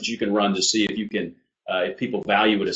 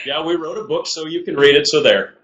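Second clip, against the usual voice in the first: lightly put-on New Brunswick accent, pitched up; 6 semitones higher than usual. Co-author jokes about their book, mock New Brunswick accent. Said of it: "you'll read it in a New Brunswick accent".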